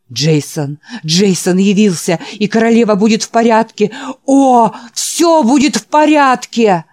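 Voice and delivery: loud, high rapturous cry; ecstatic old man. Acted. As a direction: weep for joy. Old man rejoices again. (восторженный вопль)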